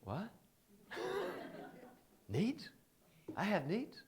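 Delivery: mock disbelief with a laugh; baffled surprise. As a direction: act shocked anyone has needs. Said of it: "and they went".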